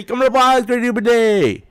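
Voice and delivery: loud, high garbled mimicry of Japanese; mock mishearing. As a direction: blurt nonsense sounds like the phrase. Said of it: "he didn't say".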